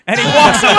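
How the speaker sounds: high, loud, laughing through it; laughing hard. Real, not acted. Comic cracking up at the story's end, laughing. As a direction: crack up at your own punchline.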